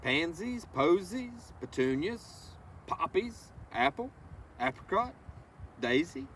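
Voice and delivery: slow, lilting read-aloud of a rhyming book; reading to children. Acted. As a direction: savor each word for the little ones. Reads picture book to children.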